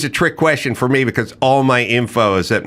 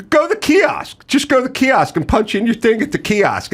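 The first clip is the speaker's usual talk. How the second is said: mock the clerk, pitched way up; pushy airport clerk; 10 semitones higher than usual. Clerk to confused traveler, mock high chirpy voice. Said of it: "They go".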